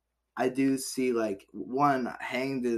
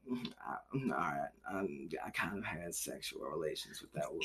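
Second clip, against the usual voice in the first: much softer, hesitant mumbled confession; sheepish admission; 7 semitones lower, 13 dB quieter than usual. Retold or imitated. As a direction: hem and haw the admission, voice dropping away. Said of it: "he's like".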